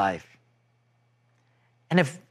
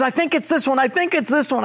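high, hesitant guessing voice; voicing the wife's nervous guess; unsure wife; 9 semitones higher than usual. Wife guessing the exit to her impatient husband, high and unsure.

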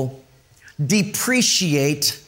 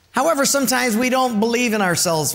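usual speaking voice

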